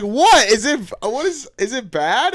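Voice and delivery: high, loud, bewildered questioning; mock innocent surprise. Kid asking parents at Olive Garden, mock innocent surprise. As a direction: act clueless and taken aback.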